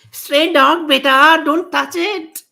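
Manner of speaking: put-on high warning to her child; protective mother. A narrator's reading. Mother warning her child about a stray, high urgent call. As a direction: pull the kid back from the dog. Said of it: "yelled a mother".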